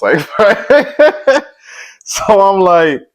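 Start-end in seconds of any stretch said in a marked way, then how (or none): 0.2-2.0 loud burst of laughter. Real, not acted. Laughing at her own scared thought.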